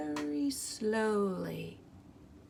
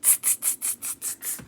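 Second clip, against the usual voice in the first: soft unvoiced tsk-tsk; cheeky hissing monkeys; teasing monkeys; 14 dB quieter than usual. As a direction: monkeys tutting back at the peddler. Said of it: "the monkeys only shook their fists at him and said".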